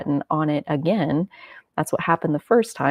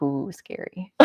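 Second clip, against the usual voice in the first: mock scared; 2 semitones lower, 6 dB quieter, slower than usual. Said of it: "And I'm like".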